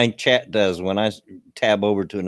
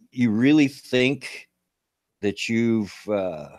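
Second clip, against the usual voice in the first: ordinary talk, nothing marked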